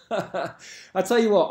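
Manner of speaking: usual speaking voice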